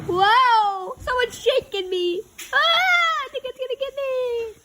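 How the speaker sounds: high, rising panic; alarmed cry. Acted. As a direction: yelp as the ground shakes. Being shaken by the swarm.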